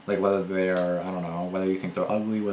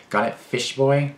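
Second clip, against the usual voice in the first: slow, louder mock of a bully; mocking imitation; 5 semitones higher, 5 dB louder, slower than usual. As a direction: draw it out, higher, mimicking the taunt. Mockingly repeats the bully's insult.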